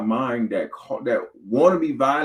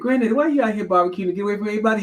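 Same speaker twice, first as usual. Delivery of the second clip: put-on high, fast little kid voice; child's voice; 11 semitones higher, 4 dB louder, much faster than usual. Grandchild teasing granddaddy at the barbecue, high and quick. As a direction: pipe up like a curious grandchild.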